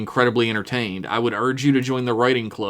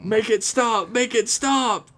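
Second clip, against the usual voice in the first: high, hammed-up wail to make it stop; mock pleading; 11 semitones higher, slower than usual. Reader hamming up a tortured listener's email, high mock plea. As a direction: plead in a strained high voice, overdone.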